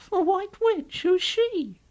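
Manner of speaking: put-on very high child's question; curious little girl. Lucy questions the faun.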